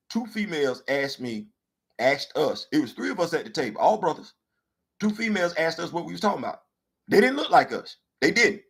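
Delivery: ordinary delivery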